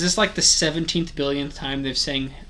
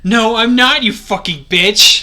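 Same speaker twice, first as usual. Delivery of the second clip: loud, high furious yell; angry shout; 6 semitones higher, 7 dB louder, slower than usual. Acted. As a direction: scream it at him. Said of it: "He shouted angrily".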